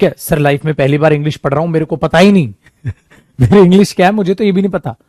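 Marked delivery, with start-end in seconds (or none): none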